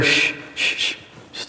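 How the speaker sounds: unvoiced shushing; hushing. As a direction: hush the crowd with a long shh. Caleb hushes the crowd.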